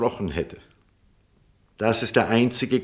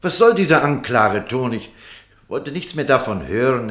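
higher and louder, offended; raised voice of wounded pride; indignant outburst; 5 semitones higher, 6 dB louder than usual. Acted. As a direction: bristle at being accused. Doctor flares up at accusation.

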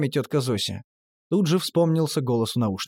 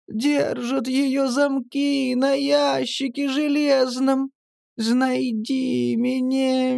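high, very slow wailing ghost voice; high, slow wailing spirit calling Mityulya; ghostly grandmother; 10 semitones higher, much slower than usual. A narrator's reading. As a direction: wail from beyond the grave. Ghost voice from headphones.

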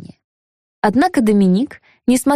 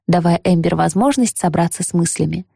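ordinary delivery

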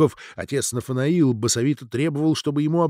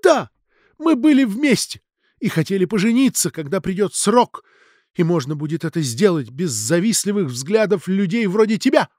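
high, emotional accusing confession; tearful outburst; 6 semitones higher than usual. A narrator's reading. Tear-stained Ksenia confesses and attacks Natalia, exclaiming. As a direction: exclaim it defiantly, ending on a high jab. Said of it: "воскликнула заплаканная Ксения".

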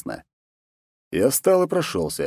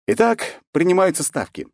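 announcing; 10 semitones higher than usual. Acted. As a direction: call for bets like a bookie. Opening the betting.

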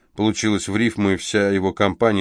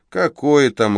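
smirking; 6 semitones higher, 5 dB louder, slower than usual. Acted. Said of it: "ухмыльнулся Шарик Иванович".

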